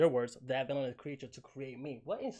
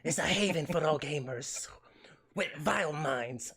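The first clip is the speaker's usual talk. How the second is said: creepy whisper; 5 dB louder than usual. Retold or imitated. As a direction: hiss it like a villain luring you in. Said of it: "that's how she talks in my head".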